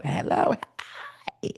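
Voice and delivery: whispered, fading greeting; whispered hello. Pretend. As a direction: breathe a hushed hi.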